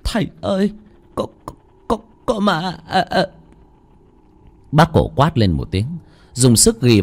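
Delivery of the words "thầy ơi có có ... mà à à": high, breathless stuttering; frightened stammer. Acted. Khoai stammers to the master, still terrified. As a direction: stammer in fear, words catching. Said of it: "ú ớ lắp bắp".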